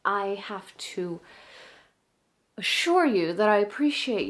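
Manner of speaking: put-on theatrical soprano addressing a young fan, halting then emphatic; dramatic monologue as an exasperated opera star; opera singer, indignant. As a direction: play a stage diva explaining herself, with wide pitch swings and long pauses.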